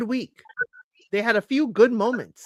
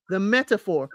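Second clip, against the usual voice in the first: ordinary talk, nothing marked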